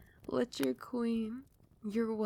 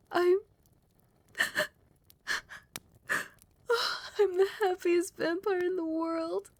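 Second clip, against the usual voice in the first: high, loud, emotional joy; overwhelmed with happiness, voice leaping up; overjoyed disbelief; 8 semitones higher, 6 dB louder than usual. Acted. Vampire queen hears a yes to her proposal, overjoyed. Marriage proposal has just been accepted.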